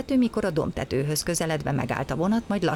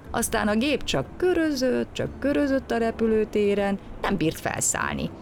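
usual speaking voice

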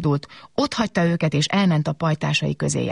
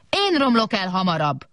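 mild, higher-pitched robot whoop; 4 semitones higher than usual. A narrator's reading. Big robot charging at the window, whooping. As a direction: whoop it without adding volume. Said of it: "kurjantott a nagy robot".

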